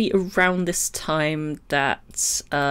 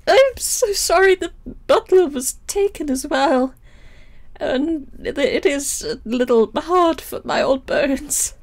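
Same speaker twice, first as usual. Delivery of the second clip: put-on old housekeeper voice; 8 semitones higher, 5 dB louder than usual.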